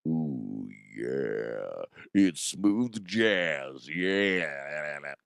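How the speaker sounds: low, drawn-out late-night radio croon; smooth jazz DJ. Pretend. Host showing off bass on the mic, smooth jazz DJ. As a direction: stretch it out slow and deep like a jazz DJ.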